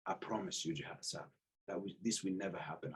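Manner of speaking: low, very soft promise; quiet solemn vow. Retold or imitated. Embarrassed athlete promising gym owner it never recurs, quiet vow.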